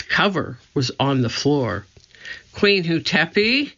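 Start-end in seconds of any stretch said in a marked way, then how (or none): 2.5-3.7 calling out. A narrator's reading. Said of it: "called Annie".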